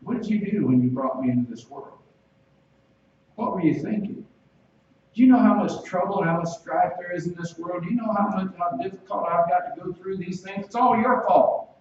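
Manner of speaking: mock indignant rant at parents, rising in pitch; hammed-up blame, peaking at the end; accusing child. Acts out child blaming parents.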